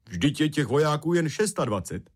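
high, animated contradiction; sly objection. A narrator's reading. Rumcajs tricking the footman over the soldier count, sly objection. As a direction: dispute it with feigned surprise, voice lifted.

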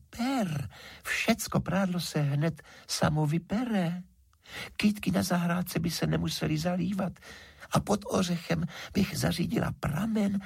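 high, coaxing fairy voice; thin high sprite making her offer; pleading water fairy. A narrator's reading. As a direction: coax and wheedle in a little high voice.

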